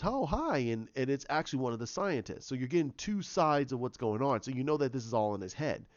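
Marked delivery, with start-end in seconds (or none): 0.0-0.6 mock bright high hello; cheery hello. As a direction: chirp a cheerful greeting, way up high. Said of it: "Rachel runs in and goes".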